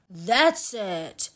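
understated exclamation; a touch of outburst; mild cry of exasperation. A narrator's reading. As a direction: declare it firmly, enough is enough. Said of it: "cried Matt".

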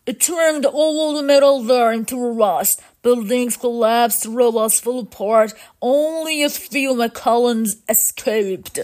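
forceful robot elder; robot leader, alarmed. Acted. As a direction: recount the disaster with mechanical force.

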